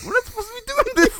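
squeaky scared whisper-shout; panicky, high-pitched worry; nervous high voice. Retold or imitated. Nervous kid voiced in story.

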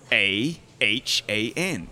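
slow, drawn-out American letters; American spelling. Shown as a demonstration. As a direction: spell the end out slowly, American style. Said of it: "put the effort into the R".

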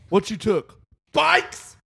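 mock inmate voice. Acts prison question.

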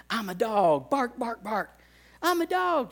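dad barking for daughter in car, high playful; dog game. Retold or imitated. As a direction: bark it out like a game. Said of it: "and I'm like".

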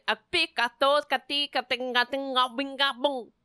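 mock gibberish tongues; fake foreign language. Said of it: "That's fake language you're speaking?".

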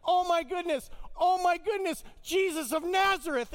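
imitating an ecstatic friend shouting in awe; freaking out. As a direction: yell it as if overwhelmed by the view. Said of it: "he started freaking out".

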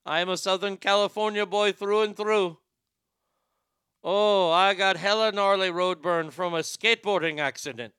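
put-on comic Indian accent trying to fit in; put-on Indian accent over California slang. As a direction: play an Indian caller passing as a surfer dude. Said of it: "when I'm in that accent and I'm trying to fit in".

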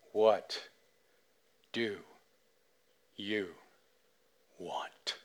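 soft, slow, drawn-out whisper. Retold or imitated. Retelling the Pepsi ad voice, slow breathy whisper. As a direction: breathe each word like a seductive ad. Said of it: "the voice then says the four words".

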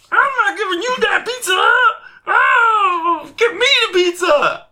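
fast, high, mock-crying squeal; high wailing voice. Retelling the pizza fight, high and mock-crying. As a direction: wail it in a high whiny voice. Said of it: "he was like".

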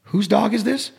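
dad marvelling at the video; imitating dad. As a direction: play the impressed old man asking. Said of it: "he's like".

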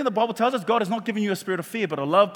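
usual speaking voice

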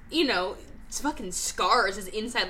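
ordinary delivery

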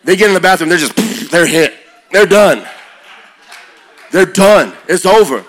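normal talking voice

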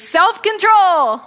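high, loud, drawn-out and smiling; smiling cheer. Shown as a demonstration. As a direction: call it out slowly with a big smile. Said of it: "with a smile on our faces".

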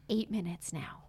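unvoiced whisper to a coworker. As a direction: whisper it to him.

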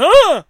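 high, forceful imitated grunt; one explosive wordless grunt; loud mock grunt. Junker pointing at cans on the ground, loud imitated grunt. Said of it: "he's pointing at me".

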